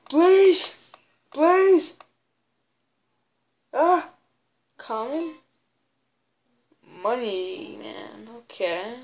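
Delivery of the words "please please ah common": high-pitched little plea; pleading. Real, not acted. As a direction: beg for it, voice pitched way up. Begging the game for a prize.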